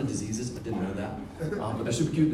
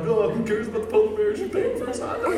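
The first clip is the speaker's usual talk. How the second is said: high-pitched, hammed-up weepy dad; mock tearful; 10 semitones higher, 5 dB louder than usual. Dad gushing over daughter buying the hot dog, mock tearful. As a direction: play overwhelmed pride, breaking into a laugh. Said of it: "I'm, like, crying".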